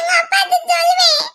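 put-on high, crying child's threat; upset child in tears; tearful tattling. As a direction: wail it as a threat to tell. Upset child threatens to tell father.

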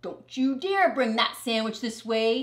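playful mock threat; mock warning. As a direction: tease him with a fake warning. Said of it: "he said".

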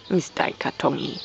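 low soft reassuring murmur; calm him gently, half whispered; soothing a child. Acted. Reassuring the cat.

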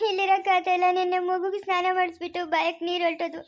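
tearful, pleading complaint; weepy and worn down; crying plea. Acted. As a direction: beg for help through tears. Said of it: "ಅಳ್ಬೇಡ ಸುಮ್ನಿರು".

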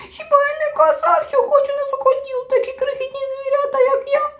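high crying whine leaning on "казав"; tearful little creature, "казав" stressed; sobbing complaint, stress on казав. A narrator's reading. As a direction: whimper "Хіба я не КАЗАВ" with weight on "казав". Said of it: "розридався".

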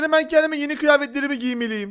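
ordinary talk, nothing marked

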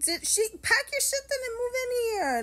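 high, loud sarcastic wail; over-the-top jealous outburst; outraged rant. Retold or imitated. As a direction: screech it in exaggerated indignation. Retelling her outburst at husband.

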